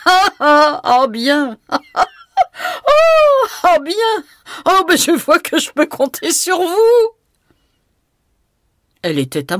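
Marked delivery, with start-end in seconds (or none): 0.1-7.1 high, loud laughing through the words; laughing heartily. A narrator's reading. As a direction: laugh out the lines helplessly. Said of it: "riait de tout son cœur". (en riant de tout son cœur, aigu et fort)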